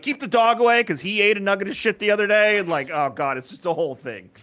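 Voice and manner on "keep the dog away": loud, high warning; shouted order. Retold or imitated. Dad shouts loudly to keep the dog from the mess. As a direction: shout it while cleaning. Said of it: "I'm like".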